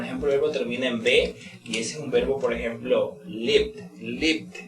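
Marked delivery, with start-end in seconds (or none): 3.3-4.6 lived, ending clearly on a D sound; lived with final D. Shown as a demonstration. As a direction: say lived, closing on the D. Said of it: "pronunciando la D".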